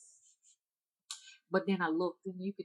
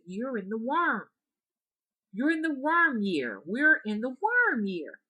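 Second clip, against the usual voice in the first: higher, louder and slow; impatient old woman; 7 semitones higher, 7 dB louder, slower than usual. Retold or imitated. Frustrated hunchback woman repeats herself, higher and slower. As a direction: hammer it home with rising impatience.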